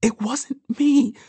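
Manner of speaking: very high, slow inner plea; high, desperate silent protest; protesting thought. A narrator's reading. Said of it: "thought Ekon".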